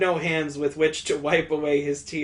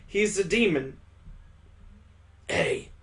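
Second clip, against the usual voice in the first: ordinary delivery, nothing marked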